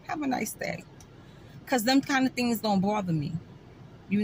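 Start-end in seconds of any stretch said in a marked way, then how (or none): 0.1-0.8 airy, sweetly dismissive goodbye; fake sweet whisper. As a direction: brush them off with a breathy nice day. Fake sweet reply to rude driver.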